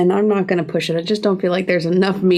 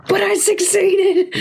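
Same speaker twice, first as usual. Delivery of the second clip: much higher, slow and laughing; excited; 11 semitones higher, slower than usual. Real, not acted. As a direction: crow it out with real delight. Triumphant after a successful dice roll.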